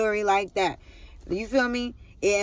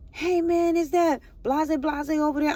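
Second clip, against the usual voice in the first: high-pitched imitation of an onlooker; bystander's voice; 7 semitones higher than usual. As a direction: lift the pitch to imitate someone calling over. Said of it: "somebody goes".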